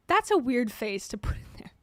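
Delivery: ordinary delivery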